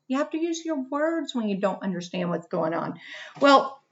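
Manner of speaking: ordinary talk, nothing marked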